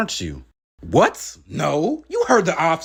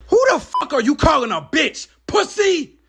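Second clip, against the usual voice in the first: furious yell; 11 semitones higher than usual. Acted. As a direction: throw the insult right back. Rapper fires back at interviewer.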